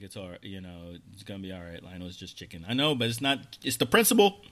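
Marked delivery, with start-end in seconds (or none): 0.0-2.6 soft, lowered soothing voice; calming wife. Retold or imitated. Wife calming her husband about the chicken, soft and soothing. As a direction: gently talk him down. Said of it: "She's like".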